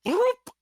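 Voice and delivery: very high, quick "bloop" noise; eyeball popping open. Acted. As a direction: mimic a little opening pop. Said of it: "the eyeball just opens up".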